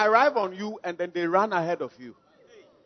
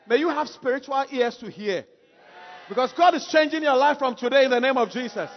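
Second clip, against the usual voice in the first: shouted blessing; 4 semitones higher, 5 dB louder than usual. Acted.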